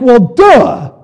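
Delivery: loud, high, scornful duh; mock duh. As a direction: toss it off like it's obvious. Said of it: "it's like".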